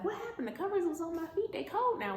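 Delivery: high, bewildered questions; confused waking. Acted. Boaz waking with cold feet, high baffled questions. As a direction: wake up baffled, voice way up.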